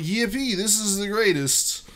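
high, loud delighted cry; excited Snubbull. Acted. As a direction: squeal with joy. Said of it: "Snubble's all like".

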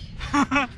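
short nervous giggle; nervous laugh. Real, not acted. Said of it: "Oh, that was a nervous laugh".

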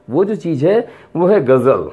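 correcting the common mispronunciation; correct ghazal. Shown as a demonstration. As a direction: give गजल a careful z sound. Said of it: "तो गजल कोई चीज नहीं है".

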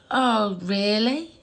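slow, questioning rise; retold wary surprise. Mum answers daughter's strange remark, retold, slow and wary. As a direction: draw it out as a careful question.

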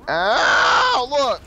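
loud yelp of pain; pained yell. Real, not acted. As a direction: yell out after getting hit. Hit in shooter game.

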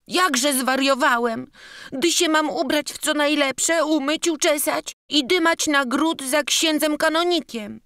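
put-on high, timid childlike apology; a scared little boy stammering out his excuse. Boy protesting.

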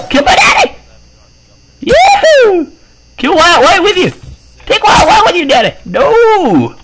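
high-pitched excited squeal; frantic high yelling. Pretend. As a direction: shriek it way up high, playfully.

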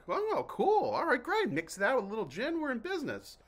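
very high, gushing mock host; delighted host. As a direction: gush with sudden delight. Acting out the host's reply.

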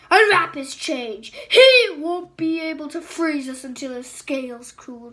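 loud dragon queen command. Acted. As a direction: bark the order over the rain. Queen ordering soldiers.

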